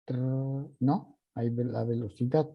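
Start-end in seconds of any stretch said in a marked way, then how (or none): 0.0-0.7 slower then faster. Shown as a demonstration. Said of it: "más lento, más rápido".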